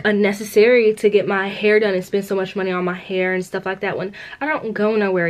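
normal talking voice

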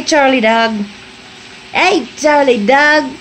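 ordinary talk, nothing marked

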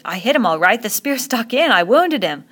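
put-on loud, eager boy's boast; excited boasting. A narrator's reading. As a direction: boast loudly, pitch leaping up. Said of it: "Ralph talked on excitedly".

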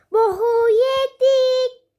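slow mock-despairing wail; dramatic groan. Real, not acted. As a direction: stretch it out like a doomed cry. Dramatic wail over in-game setback.

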